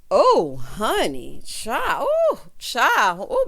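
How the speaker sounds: loud, slow, drawn-out oh honey; shocked gasp. Real, not acted. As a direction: react to the couple's fight in disbelief. Couple's fight shocks the viewer.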